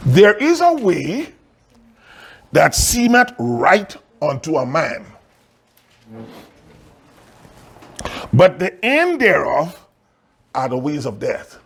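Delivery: deliberate pulpit cadence, dropping away at the close; scripture reading. Acted. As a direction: announce the text gravely, phrase by phrase. Reads scripture text with long pauses.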